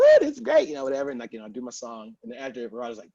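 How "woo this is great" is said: high, loud whooping; crowd cheer. Retold or imitated. Crowd reacting to his song, whooping. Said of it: "everyone's like".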